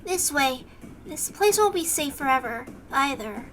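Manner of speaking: put-on low, soft, shy girl's voice; soft and quiet. Une leads them through the window, soft and quiet. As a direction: keep it hushed and shy. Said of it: "make it kind of soft and quiet".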